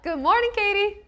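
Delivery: ordinary delivery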